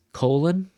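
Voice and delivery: "colon" with the first syllable hit hard; stress on first syllable. Shown as a demonstration. Teacher showing students where the stress in "colon" falls. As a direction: land on "co" in "colon". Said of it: "capital for the strong one".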